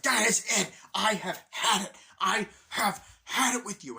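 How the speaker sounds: loud, high exasperated rant; breaking point. Retold or imitated. Man turns the light on his roommate, angry outburst. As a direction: punch out each word in fed-up fury. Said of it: "it's like his breaking point".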